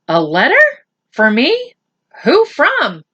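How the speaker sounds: disbelieving, climbing up; astonished. Acted. Boy shocked by a letter.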